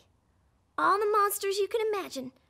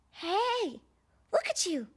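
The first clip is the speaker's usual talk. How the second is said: ordinary talk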